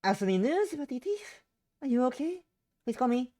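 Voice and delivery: high-pitched urgent mother; worried mother. Acted. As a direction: voice an anxious mum checking in. Voices mother's worried text message.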